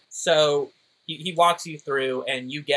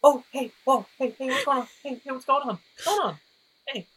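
high, panicky friend on phone; frantic worried voice; alarmed Tyler; 11 semitones higher, faster than usual. Acted.

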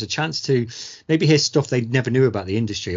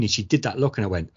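usual speaking voice

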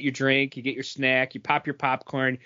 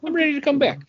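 mock Larry; 12 semitones higher, faster than usual. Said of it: "and then he's like".

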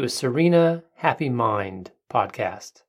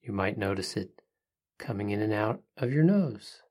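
soft spa whisper; 5 dB quieter than usual. Acted. Leads a breathing meditation.

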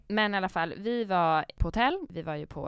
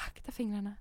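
softer careful caution; soft warning; 2 semitones higher, 8 dB quieter than usual. Retold or imitated. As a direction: warn the child quietly. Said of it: "Jag var så här". (mjuk tyst varning akta fingrarna)

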